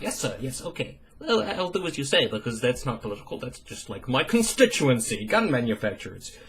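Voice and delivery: mock groveling to a boss, hushed; servile Paul Ryan. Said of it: "Paul Ryan would be like".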